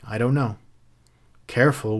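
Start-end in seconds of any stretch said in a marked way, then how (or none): none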